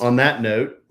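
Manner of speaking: ordinary talk, nothing marked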